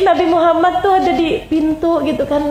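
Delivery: usual speaking voice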